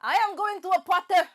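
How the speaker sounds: loud, high Indian accent. Shown as a demonstration. Host retrying the challenge, loud Indian accent. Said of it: "in India".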